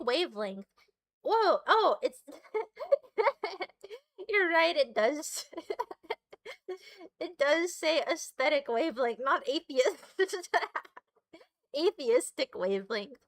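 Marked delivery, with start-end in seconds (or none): 2.3-4.0 high burst of real laughter; laughing. Real, not acted. Amused at own misreading. 5.5-6.8 high, softer giggling fit; laughing. Real, not acted. Amused at own misreading. 10.2-11.0 high giggle at your own slip; laughing. Real, not acted. Amused at own misreading.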